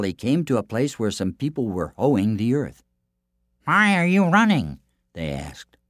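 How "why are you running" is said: higher-pitched villagers' question; lightly voiced villagers, pitched up. A narrator's reading. Farm workers question a running stranger, high questioning voice.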